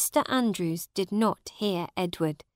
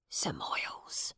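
breathy hushed whisper, much lower and softer; 12 semitones lower, 8 dB quieter than usual. A narrator's reading. Old servant kneeling before Miles, whispering. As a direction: whisper his master's name. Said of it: "he whispered".

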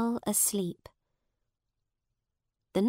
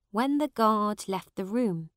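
ordinary delivery

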